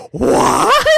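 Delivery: loud, high-pitched squawk; hammed-up astonished yelp; mock shock. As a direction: shriek it in comic disbelief. Acting shock at the alien's twist.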